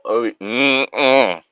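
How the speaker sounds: ordinary delivery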